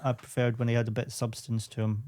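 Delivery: ordinary delivery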